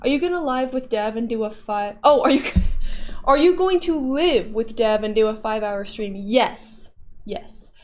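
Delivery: ordinary talk, nothing marked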